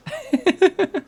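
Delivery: a bright high burst of laughter. Acted. Firefighter laughing off jokes about his name, high laugh.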